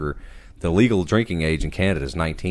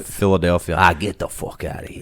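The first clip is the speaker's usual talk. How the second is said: put-on Philly accent. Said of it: "I'm like".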